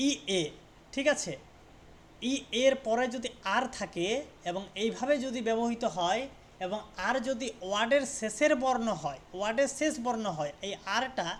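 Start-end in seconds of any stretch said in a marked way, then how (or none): none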